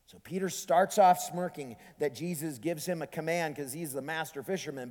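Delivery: ordinary delivery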